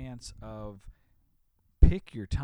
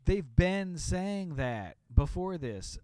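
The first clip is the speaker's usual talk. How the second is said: ordinary delivery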